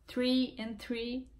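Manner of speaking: "Three and three" said slowly as tree then three; slow contrast of "Three and three". Shown as a demonstration. Showing viewers the difference between "tree" and "three", slowly. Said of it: "a diferença de árvore e de número três".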